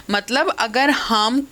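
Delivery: normal talking voice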